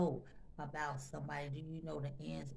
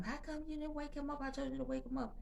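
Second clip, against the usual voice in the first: retold screaming; 10 semitones higher than usual. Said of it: "she's screaming through the house".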